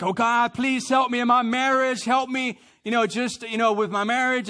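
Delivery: high, loud, whiny plea for help; mock pleading prayer. Husband praying about his marriage, mock desperate plea. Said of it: "We're like".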